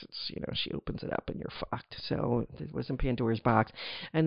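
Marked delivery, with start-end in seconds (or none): 0.0-2.0 whispered aside, much softer; conspiratorial whisper. Real, not acted. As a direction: drop to a breathy whisper for the punchline. Aside about Pandora's box.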